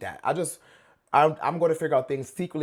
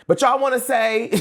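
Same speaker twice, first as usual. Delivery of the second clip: excited; 8 semitones higher, 5 dB louder than usual. Real, not acted. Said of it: "I'm getting excited".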